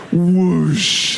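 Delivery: long drawn-out whoosh; imitated whoosh. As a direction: make a sweeping sound effect. Imitates Prince suddenly appearing.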